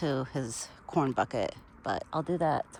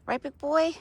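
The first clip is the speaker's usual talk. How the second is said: pet talk; 10 semitones higher, 5 dB louder than usual. Real, not acted. Praising Buck directly.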